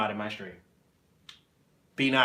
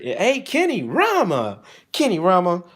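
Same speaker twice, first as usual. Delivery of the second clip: calling out; 7 semitones higher, 6 dB louder, slower than usual. Real, not acted. Greeting a viewer in chat.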